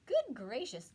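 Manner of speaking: loud gasp of surprise. A narrator's reading. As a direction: gasp it in shock. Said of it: "gasped Maisie".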